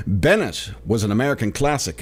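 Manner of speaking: ordinary talk, nothing marked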